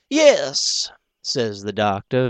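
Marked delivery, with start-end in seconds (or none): 0.1-0.9 gentle, unhurried gentleman; gentle and easy. Acted. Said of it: "very gentle and easy".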